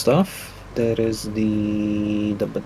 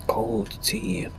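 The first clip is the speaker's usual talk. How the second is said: high hushed cold tea; whispered; 8 semitones higher, 4 dB quieter than usual. Real, not acted. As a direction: whisper it in dismay. Sips cold tea, disappointed.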